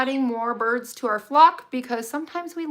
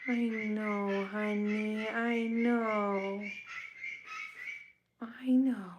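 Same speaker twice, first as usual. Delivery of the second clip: soft soothing whisper; 11 dB quieter, much slower than usual. Real, not acted. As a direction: whisper it to calm the bird. Hushing a fussing bird.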